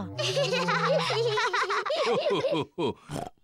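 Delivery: high, loud hearty laugh; big relieved laugh. Acted. Daddy Pig laughs loudly, glad the fly is gone.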